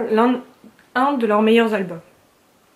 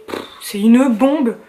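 ordinary talk

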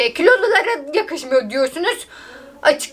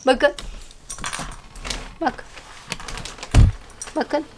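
ordinary delivery

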